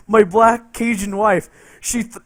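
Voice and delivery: ordinary talk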